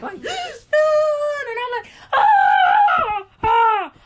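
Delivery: very high, loud crying out; anguished cry. Retold or imitated. Patient jolts awake in pain, crying out very high and loud. Being shocked back to life.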